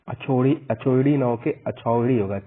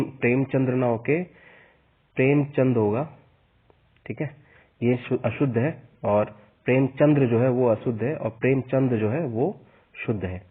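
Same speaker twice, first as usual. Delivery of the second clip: normal talking voice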